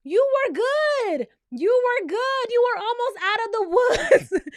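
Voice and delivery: high, loud, drawn-out dismay; exasperated lament. Acted. Host lamenting that Wes was almost safe, exasperated. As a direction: wail it slowly, breaking into laughter at the end.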